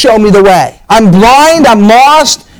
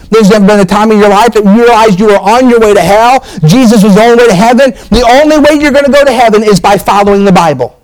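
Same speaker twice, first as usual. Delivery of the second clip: preaching at full force; 4 semitones higher than usual. Acted. Preacher urging the church toward salvation, rising fervent preaching. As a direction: preach it, building and pushing hard.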